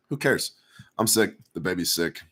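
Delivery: ordinary talk, nothing marked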